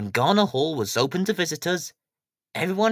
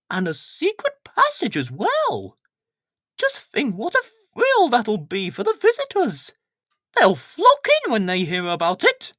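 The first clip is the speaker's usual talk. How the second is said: much higher, gushing lady's voice climbing with delight; a fussy curator overjoyed by history; thrilled Miss Hubbard; 14 semitones higher, 4 dB louder than usual. Acted. Miss Hubbard gushes over papers.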